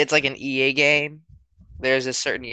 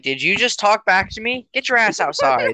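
scolding parent; 6 semitones higher than usual. Retold or imitated. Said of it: "They're like".

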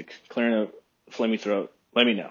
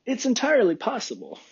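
lightly imitated catchphrase, pitched up high; Joe Rogan catchphrase; 11 semitones higher than usual. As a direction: quote it as a higher-pitched mock catchphrase. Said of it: "gets made fun of for always saying".

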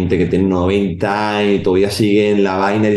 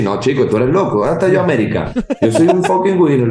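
playful hype; 6 semitones higher than usual. Retold or imitated. Coach hyping up the kids he trains, playful brag building to a laughing high. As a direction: build from joking to a laughing boast. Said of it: "Yo los trato así".

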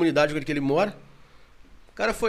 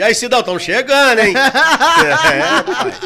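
laughing call-out; 6 semitones higher, 10 dB louder, much slower than usual. Real, not acted. Calling out to Cid laughing.

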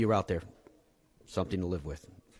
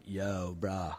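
frat bro; 4 semitones lower, 9 dB quieter than usual. Retold or imitated. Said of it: "are like".

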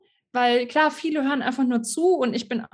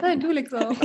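laughing lover tease. Pretend. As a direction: break into a laugh on it. Mock lovers' phone hang-up game.

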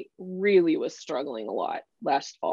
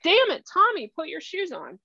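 sharp, much higher start, dropping off at the end; exasperated parent; 10 semitones higher than usual. Retold or imitated. Parent scolding a four-year-old, fed-up and higher. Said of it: "We'll talk to a four-year-old that way".

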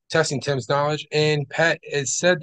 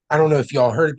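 ordinary delivery

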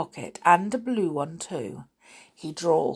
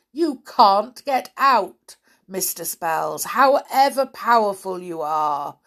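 gloating giant; 4 semitones higher, 4 dB louder than usual. Acted. Giant Twisty taunting Mister Spells through the door.

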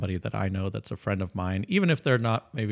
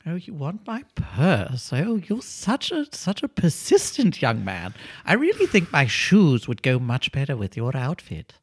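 put-on high, sweet old lady voice; 5 semitones higher, 3 dB louder than usual. Old lady happily answering the burglar, high sweet voice. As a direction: play a doting old lady.

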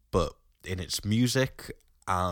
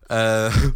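loud sudden burp; 4 semitones higher, 11 dB louder than usual. Real, not acted. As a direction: let out a real burp. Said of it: "sorry, I needed to burp there".

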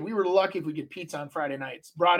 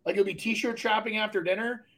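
ordinary delivery

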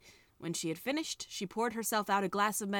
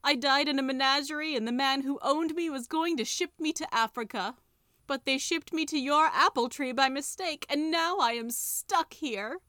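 high, louder lament of a stranded creature; tiger skin wailing; 5 semitones higher, 4 dB louder than usual. A narrator's reading. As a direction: wail it like a lost creature. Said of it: "wailed the tiger skin once more".

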